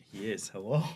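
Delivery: ordinary delivery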